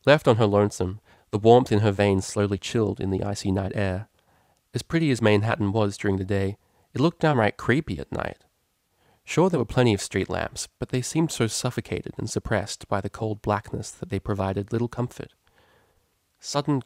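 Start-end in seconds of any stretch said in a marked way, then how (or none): none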